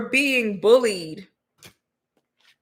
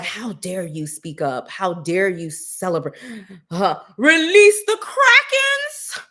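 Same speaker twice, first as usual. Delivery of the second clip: scold, then shriek the order to unleash the monsters; mock outraged official; 5 dB louder than usual. Mocking officials who punish silence, shrieking melodramatically.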